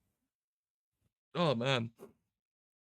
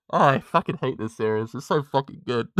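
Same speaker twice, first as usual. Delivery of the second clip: loud frustrated rant; 7 dB louder than usual. Real, not acted. Overwhelmed by the show.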